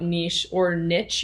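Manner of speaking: niche first as "neesh", then niche as "nitch"; two pronunciations. Shown as a demonstration. Host defining a term for younger listeners, niche said as neesh or nitch. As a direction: say niche both ways, long ee then short i with tch. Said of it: "you might have heard it called".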